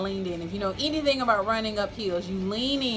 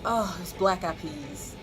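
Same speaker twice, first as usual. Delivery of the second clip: sheepish confession; 3 semitones lower than usual. Real, not acted. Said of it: "I'm ashamed to admit it".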